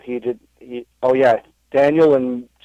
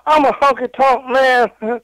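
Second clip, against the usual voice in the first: higher, slow Honky Tonk Man croon; 12 semitones higher, slower than usual. Pretend. Trying a first Honky Tonk Man impression on request, high and slow. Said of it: "I don't know if I have a honky impression".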